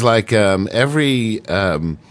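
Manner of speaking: ordinary delivery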